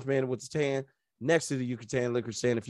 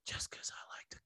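sly whispered punchline; 20 dB quieter, faster than usual. Acted. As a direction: drop the joke in a hush.

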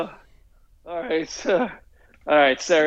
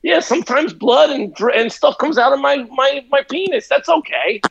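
higher-pitched, hurried-off excuses in a put-on voice; mock stubborn patient; 8 semitones higher than usual. Stubborn patient refusing to get the shot, mock dismissive. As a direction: play down the problem in a high, defensive mock voice. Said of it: "So I'm like".

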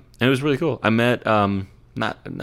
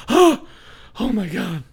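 much higher gasping cry; gasping fright; 11 semitones higher, slower than usual. Retold or imitated. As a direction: play the wife's sudden scare.

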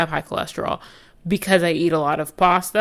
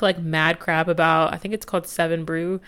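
ordinary talk, nothing marked

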